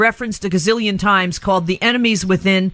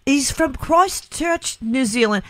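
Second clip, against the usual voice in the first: badly faked New Zealand accent, drawn out; 4 semitones higher, slower than usual. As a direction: attempt a Kiwi accent, landing nearer Liverpool. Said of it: "it's just such a great accent".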